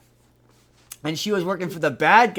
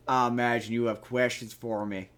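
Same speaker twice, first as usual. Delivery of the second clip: imitating Southern accent; 5 semitones lower, 3 dB quieter than usual. Movie character turns up at the book reading, thick Southern accent. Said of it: "like, Southern accent".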